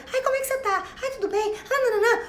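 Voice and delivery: high, fussy mocking voice; mock the worried friend who keeps calling; whiny clingy caller. (imitação aguda e chorosa de quem liga toda hora)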